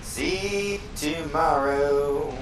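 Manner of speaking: very slow dramatic threat; slow mock threat. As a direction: drag out the villain line.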